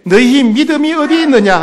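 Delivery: high, loud challenge. Acted. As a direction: call them out on their faith.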